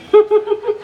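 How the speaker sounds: high squeaky burst of laughter; mock laugh. Buffaloes laughing, joke. (उंच खिदळणारे हसू)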